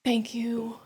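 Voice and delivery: a soft, breathy thank you; whispered thanks. Real, not acted. Thanking God quietly, in a whisper. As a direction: barely voice it.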